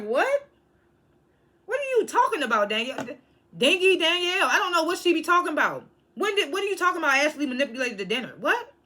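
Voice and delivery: baffled disbelief. Retold or imitated. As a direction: react like she said something absurd. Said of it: "And I'm just like".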